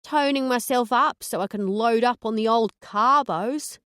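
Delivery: sweet angelic Aussie gal; Aussie accent. Acted. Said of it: "phasing in the Aussie accent".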